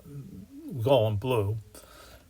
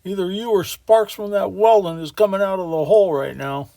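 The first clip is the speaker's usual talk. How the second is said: louder, high-pitched angry foreman; high tenor yell; 8 semitones higher, 7 dB louder than usual. A narrator's reading. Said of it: "Irish tenor voice, which has gone up an octave now, yells down".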